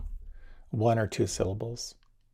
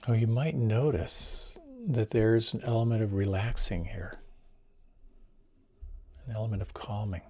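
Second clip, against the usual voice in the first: guided meditation. Acted. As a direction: ease the listeners into calm.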